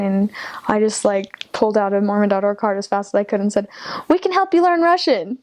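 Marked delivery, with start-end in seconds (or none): 4.1-5.4 eager sales pitch. Retold or imitated. Rushed pitch as guy leaves bus.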